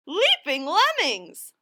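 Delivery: high, slow, loud snuffling exclamation; cartoon critter gasp; snuffle. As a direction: snuffle it like a startled little beast. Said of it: "snuffled Harry Clary".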